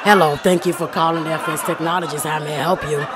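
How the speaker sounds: imitating a low, raspy phone greeting; low rasp like a chain smoker answering the phone. Coworker answering a customer call in a mock raspy voice. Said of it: "that was the most raspiest voice".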